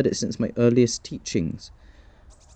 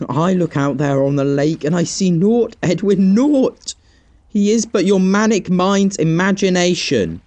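angry outburst; 5 semitones higher, 3 dB louder than usual. Acted. Friend insists he sees a figure.

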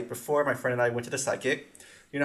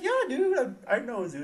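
laid-back alternative; 10 semitones higher than usual. Shown as a demonstration. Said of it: "just be like".

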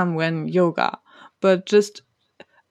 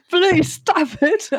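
high, slow, pained whine; mock pleading whine; 10 semitones higher, slower than usual. Annoyed by the meditation voice, a high drawn-out plea. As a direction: beg it to stop in a hammed-up whine.

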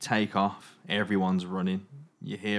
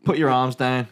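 louder barked command; shouted order; 5 semitones higher, 8 dB louder than usual. Acted. Soldier orders survivors at gunpoint, loud shout.

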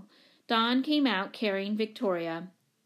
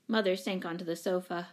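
ordinary delivery

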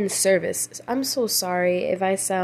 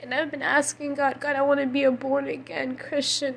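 higher, softened crying voice; tearful earnest pleading; 4 semitones higher, 4 dB quieter than usual. Real, not acted. Speaker asking God to never be stagnant, tearful. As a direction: plead through tears, pitched high.

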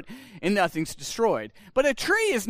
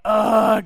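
loud exasperated groan; fed-up ugh, louder; frustrated groan; 8 dB louder than usual. Retold or imitated. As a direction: groan like a sulky believer. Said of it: "He's not talking about, like".

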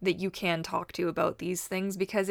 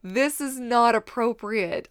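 indignant; 5 semitones higher, 5 dB louder, slower than usual. Retold or imitated. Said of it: "I'm just like".

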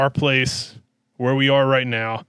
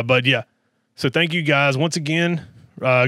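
ordinary talk

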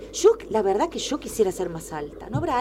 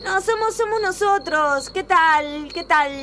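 high, loud overfriendly greeting; nervous fake cheer; 8 semitones higher, 5 dB louder, slower than usual. Caught girls greeting the janitor: high nervous fake cheer. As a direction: cover being caught with a sing-song hello.

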